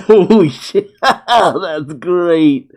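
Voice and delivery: laughing through it; real laugh breaking the words. Real, not acted. Fan laughing at the manga, delighted.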